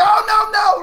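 high, loud "Oh no, no!"; mock panicked shout. Retelling a defender's panic to his co-host, a loud mock shout. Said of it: "He's like".

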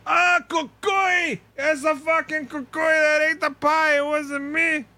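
high, slow creepy doll voice with a shout on cucuy; Chucky-like dad voice. Retold or imitated. Dad blaming the cucuy for the pie, in a high creepy voice. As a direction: squeal it like a scared killer doll. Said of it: "her dad sounds like Chucky".

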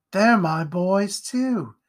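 high, very slow, stretched words; taunting mother. A narrator's reading. Mother reveals her gang to Jack, taunting. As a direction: draw it out with sly pleasure.

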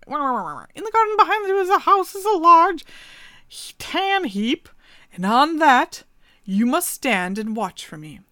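the raven instructing the man; raven princess. Acted. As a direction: speak as the cursed princess.

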